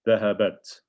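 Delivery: Dhahabat with the final ta stopped short; clear sukoon on ta. Shown as a demonstration. Teacher models the feminine ending, Dhahabat said with a crisp closed t. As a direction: say Dhahabat, closing on a crisp silent t. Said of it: "put a sukoon on it".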